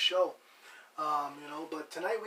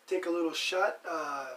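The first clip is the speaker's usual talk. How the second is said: ordinary talk, nothing marked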